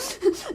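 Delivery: quick rising giggle; a gleeful little giggle; a bright, gleeful laugh. Acted. Boy laughs before confessing.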